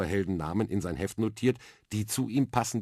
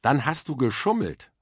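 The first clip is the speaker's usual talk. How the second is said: put-on louder accusing boy, higher pitch; reproachful; 7 semitones higher, 6 dB louder than usual. Arthur accuses the girl of cheating, reproachful and raised. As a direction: call her out for cheating. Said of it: "warf er dem Mädchen vor".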